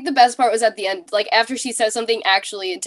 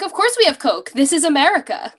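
higher, louder, mock-offended matter of fact; mock indignant; 6 semitones higher, 3 dB louder than usual. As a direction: play it as staff baffled by the question. Voicing cafeteria staff, mock indignant.

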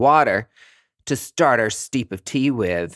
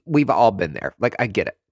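ordinary delivery

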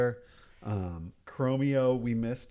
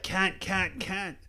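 high-pitched mock Australian squawks; playful Australian Santa impression, pitched up; 8 semitones higher, slower than usual. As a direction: repeat it in a hammed-up Australian accent. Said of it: "No, he's more like".

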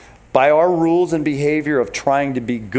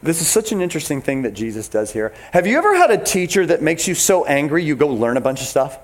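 normal talking voice

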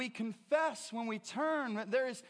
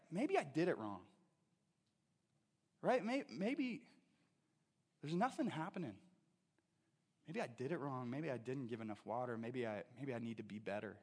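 fading much softer and lower; quiet self-doubt; 8 semitones lower, 7 dB quieter than usual. Retold or imitated. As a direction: let the doubt sink away to almost nothing.

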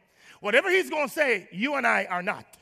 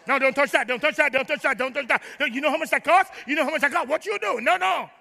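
mock-panicked, higher-pitched rapid repeats; fussy homeowner; 4 semitones higher than usual. House owner warning guests off costly things, mock alarm. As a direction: play a house-proud host fending off guests' hands.

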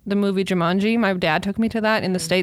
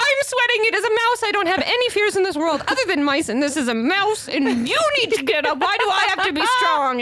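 panicked high pleading; 9 semitones higher than usual. Retold or imitated. Retold panicked reply in dark.

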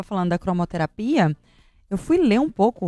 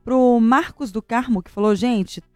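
normal talking voice

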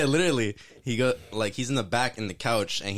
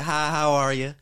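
cheerful cashier greeting; 6 semitones higher, 3 dB louder than usual. Retold or imitated. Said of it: "She's like".

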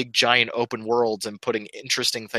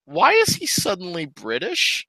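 baffled; 6 semitones higher than usual. Retold or imitated. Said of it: "I was like".

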